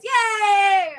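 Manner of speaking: loud, high cheering yay; crowd cheer. Acted. The crowd cheering to keep the void, loud and high. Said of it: "And everyone goes".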